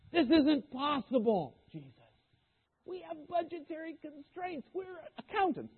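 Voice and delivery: protesting disciples. Retold or imitated.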